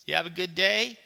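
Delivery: high, loud question with a lift at the end; enthusiastic. Acted. Maid asks the boy about his day, enthusiastically. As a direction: ask it with eager cheer. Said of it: "she said enthusiastically".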